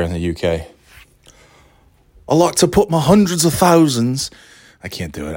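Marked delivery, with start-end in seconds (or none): 2.3-4.3 higher-pitched, put-on British accent; mock UK accent. Joking about UK word for sprinkles, mock British accent. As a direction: attempt a hammed-up British accent. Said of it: "I can't do the accent anymore".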